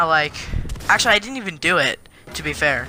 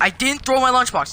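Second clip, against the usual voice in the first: higher-pitched retold protest; mock raised voice; 7 semitones higher than usual. Kid protesting innocence to the principal, mock raised voice. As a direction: insist with the pitch raised, mildly. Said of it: "don't raise your voice at me".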